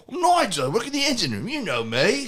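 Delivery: high, put-on accent with a laugh in it; fake British accent. Retelling the bluff to the guard, in a fake accent. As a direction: ham up the made-up accent. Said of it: "I just did an accent".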